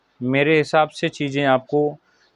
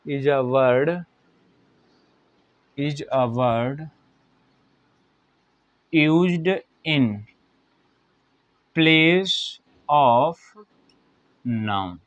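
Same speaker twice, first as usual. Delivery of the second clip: drawn-out words with long pauses; slow dictation; much slower than usual. Real, not acted. Teacher giving pupils time to write, slow, halting dictation. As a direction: dictate piece by piece at writing speed. Dictating word by word while writing.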